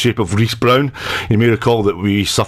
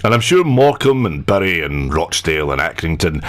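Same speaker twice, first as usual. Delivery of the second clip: ordinary delivery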